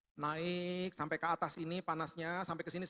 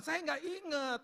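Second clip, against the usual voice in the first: high-pitched protest; exasperated retold reply; 10 semitones higher, slower than usual. Sick man answering God that he forgot, exasperated. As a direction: plead helplessly, pitch jumping up.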